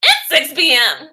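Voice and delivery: hammed-up smug viewer; mock correction. Viewer correcting the streamer about her time zone, mock smug. Mocking people in other time zones.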